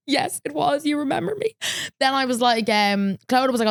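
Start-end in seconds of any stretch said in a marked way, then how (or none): 0.1-1.6 high, delighted fangirl squeal; excited fan. Retold or imitated. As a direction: gush like a starstruck fan. Fan gushing to [name].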